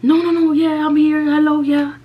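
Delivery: hearing his father for the first time, voice trembling; shaking with nerves. Retold or imitated. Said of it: "I was shaking".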